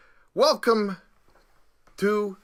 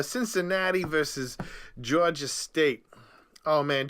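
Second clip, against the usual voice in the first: normal talking voice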